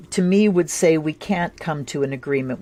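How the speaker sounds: ordinary talk, nothing marked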